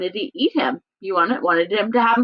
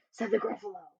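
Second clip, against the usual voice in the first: plain reading voice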